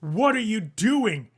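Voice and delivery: loud, high, drawn-out demand; Vanathra outraged. Acted. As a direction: cry out slowly in indignant disbelief. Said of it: "And Vanathra's like".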